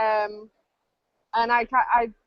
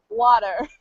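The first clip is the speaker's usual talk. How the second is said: "water" with an American pronunciation; American accent; 5 dB louder than usual. Retold or imitated. Brit explaining how Americans say it, imitated American accent. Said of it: "that's because they say".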